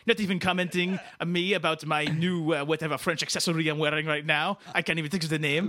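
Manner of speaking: put-on indignant French-Canadian accent; wounded French-Canadian rant; Quebecois Dave. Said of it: "our French-Canadian friend".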